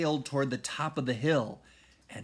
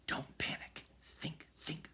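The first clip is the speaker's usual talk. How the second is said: anxious whisper, fading softer; urgent whisper; 3 semitones higher, 12 dB quieter than usual. A narrator's reading. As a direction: hush yourself, afraid to be heard. Jack calming himself, T-Rex near.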